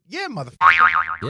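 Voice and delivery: loud, cocky "Yeah, motherfucker"; defiant loud retort. Retold or imitated. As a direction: fire the "Yeah" back loud. To which I replied.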